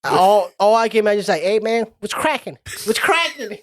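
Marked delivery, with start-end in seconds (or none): 1.4-3.6 cocky alien greeting. Retold or imitated. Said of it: "and he's like".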